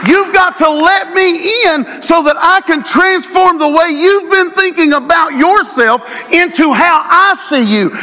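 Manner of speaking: preacher voicing God to the congregation; impassioned preaching. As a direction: cry it out as God's invitation. Voicing God's plea.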